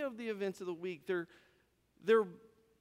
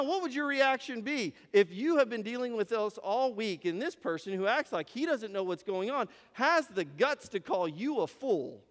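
normal talking voice

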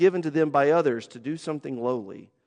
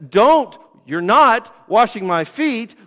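emphatic refusal; 5 semitones higher, 8 dB louder, slower than usual. Retold or imitated. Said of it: "he says".

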